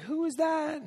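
high-pitched curious wife; imitated wife. Wife asking husband about the visitor, imitated high voice. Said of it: "my wife's like".

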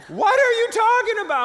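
very high, loud mock shriek; huge-font yelling. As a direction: yell it like giant-font email. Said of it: "she wrote back with, like, twenty-two point font".

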